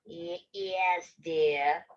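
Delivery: low, halting fake-granny rasp; scratchy voice. Wolf poses as sick Grandma, scratchy low voice. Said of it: "came a scratchy voice".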